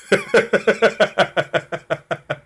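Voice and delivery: high, rapid, sustained giggling; long laugh. Real, not acted. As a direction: break up laughing at your own story. Laughing at rude spirit guide.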